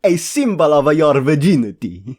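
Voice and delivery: hammed-up Italian accent, slow and loud; put-on Italian accent. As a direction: draw it out in a big mock Italian accent. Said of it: "let me do that in the accent".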